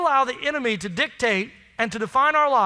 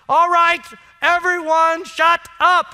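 louder and higher, exasperated shout; fed-up parent yell; 5 semitones higher, 7 dB louder, slower than usual. Retold or imitated. Ungodly parent yelling at his kids to shut up. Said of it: "I'm like".

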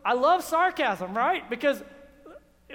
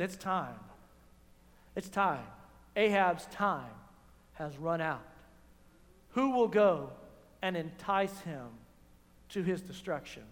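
much lower, softer, grave; solemn God voice; 8 semitones lower, 5 dB quieter than usual. Acted. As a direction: divine pronouncement to heaven.

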